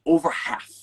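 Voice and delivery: usual speaking voice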